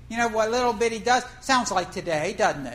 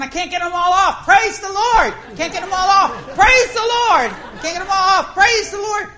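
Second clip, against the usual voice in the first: high, loud frantic praise shouts; overwhelmed mate shouting praise as fish pile up; excited shouting mate; 8 semitones higher, 9 dB louder than usual. Retold or imitated. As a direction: yell it while rushing back and forth, overwhelmed. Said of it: "The mate's going, running back and forth".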